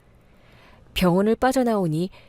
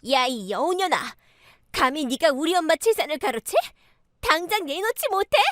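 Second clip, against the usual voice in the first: high, indignant scolding; angry accusation; 8 semitones higher than usual. Acted. Second sister-in-law grabs the narrator's hair, scolding angrily. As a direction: demand it back in a rising, high-pitched fury.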